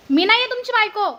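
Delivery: very loud, forceful retort; angry denial. Acted. Woman telling drunk stranger she is not his wife, angry shout. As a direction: shout him down.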